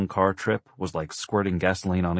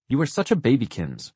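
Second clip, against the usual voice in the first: put-on high, taunting little-girl voice; indignant big-girl tone; 7 semitones higher than usual. A narrator's reading. Six-year-old sister taunting her little brother, indignant big-girl tone. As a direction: needle him like a superior big sister. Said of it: "her most indignant big girl tone".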